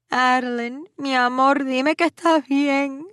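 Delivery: high, loud, worried Spanish plea; almost scream it down the phone; near scream. A narrator's reading. Worried mother almost screaming down the phone. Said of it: "all but screamed into the phone".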